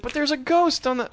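high-pitched boy's mock panic; alarmed protest. Boy pleading with his parents about a ghost, high alarmed whine.

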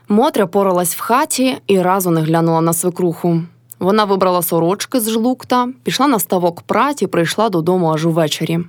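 ordinary delivery

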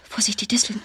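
urgent low whisper; hushed warning while running. Acted. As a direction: warn yourself under your breath.

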